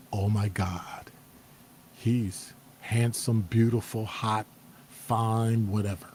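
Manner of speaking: breathless whisper, drawn out and slow; swooning whisper. Retold or imitated. First glimpse of a handsome guy, swooning whisper. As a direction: sigh it out, dazzled. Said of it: "the first thought you have is".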